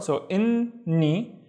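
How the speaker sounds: in ni split and drawn out slowly; slow syllables. Shown as a demonstration. Teacher building the word syllable by syllable. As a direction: stretch each syllable slowly. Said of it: "The second syllable is just ni".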